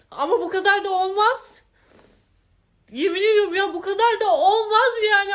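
high, loud, tearful angry outburst; shouting through tears of anger; crying with rage. Real, not acted. Furious and tearful, telling Ayşenur she has had enough. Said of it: "sinirden ağlayacağım".

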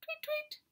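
very high tweet tweet; the dawn birds chirping, very high; birdsong. A narrator's reading. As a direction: chirp like a little bird. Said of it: "went the birds".

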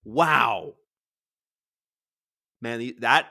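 ordinary talk

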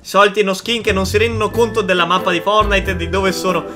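ordinary talk

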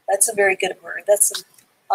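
usual speaking voice

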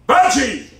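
high, loud triumphant cry; zealous convert. Real, not acted. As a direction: shout it out in triumph. Preacher testifies over his trials.

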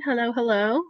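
ordinary talk

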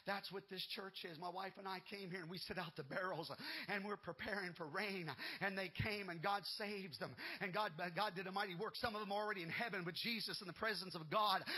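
ordinary delivery

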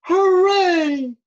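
loud, long-held hooray; high, booming whoop of joy; bellowed cheer. Acted. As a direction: cheer it out as a delighted bear. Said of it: "he bellowed".

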